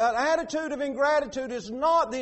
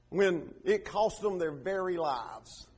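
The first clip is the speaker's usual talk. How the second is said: ordinary talk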